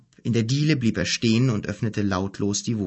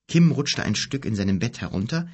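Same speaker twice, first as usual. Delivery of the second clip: ordinary delivery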